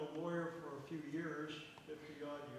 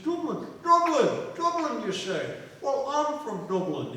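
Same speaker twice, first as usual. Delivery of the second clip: high, loud, swooping exclamations; delighted amazement; 9 semitones higher, 14 dB louder than usual. Acted. Drinkers finding a shared hometown, delighted amazement. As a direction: cry out in mock astonishment, falling from a high pitch.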